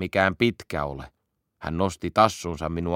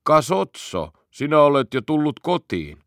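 gruff voice; 6 semitones higher than usual. Pretend. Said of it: "Sanoin römeällä äänellä".

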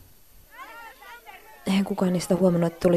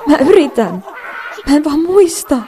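much higher and louder, near tears; breaking down in front of class; panicked cry; 8 semitones higher, 8 dB louder than usual. Acted. Panics blanking at presentation. (paniikissa itkun partaalla)

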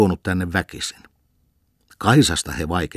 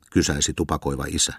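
usual speaking voice

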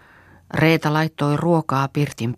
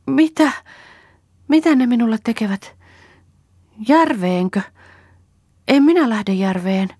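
higher, louder voice of a startled woman talking to a vision; wide-eyed questions pitched up high; excited, bewildered Kerttu; 8 semitones higher, 4 dB louder than usual. A narrator's reading. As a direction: act out her agitated wonder. Deranged Kerttu greets imagined Ella.

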